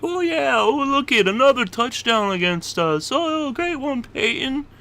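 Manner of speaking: high, loud, gushing fanboy voice; mocking starstruck player. Mocking Niners players who cheer Peyton's touchdown, high gushing fanboy. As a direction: ham up a dazzled player admiring the enemy. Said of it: "watching him like".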